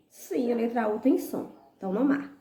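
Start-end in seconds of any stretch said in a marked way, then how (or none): none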